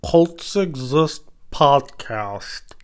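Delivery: drawn-out mock radio host intro; slow, stretched podcast host voice; hammed-up show opener; announcer intro. Shown as a demonstration. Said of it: "That's enough of that".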